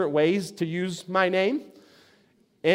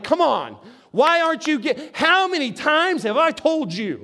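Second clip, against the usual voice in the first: frustrated; 6 semitones higher than usual. Retold or imitated. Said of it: "I would be frustrated".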